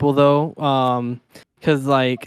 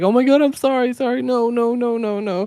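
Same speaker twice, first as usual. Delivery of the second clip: high-pitched, flustered backpedalling; mock panicked apology; 9 semitones higher than usual. People scared they made her cry, mock panic. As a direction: imitate someone frantically apologizing in a high voice.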